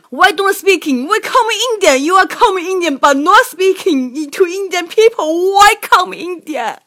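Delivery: 学印度小贩高声怒吼质问; 又高又响，夸张地连声质问; 模仿怒吼. Retold or imitated. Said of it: "开启了怒吼模式". (扯着嗓子模仿小贩怒吼)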